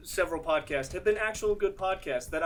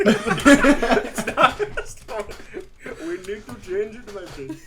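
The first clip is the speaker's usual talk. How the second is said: laughing; 4 semitones higher, 7 dB louder, much slower than usual. Real, not acted. Laughing denial of accent.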